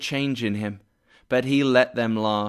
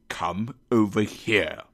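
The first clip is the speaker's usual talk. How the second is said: low, menacing mock-stern order; deep, husky pretend-angry command; feigned anger; 4 semitones lower than usual. A narrator's reading. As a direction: summon a clerk in a put-on boss growl. Scrooge feigns anger at Bob.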